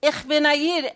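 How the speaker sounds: retold screaming. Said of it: "I kept screaming".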